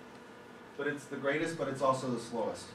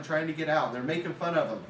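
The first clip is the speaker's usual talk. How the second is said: usual speaking voice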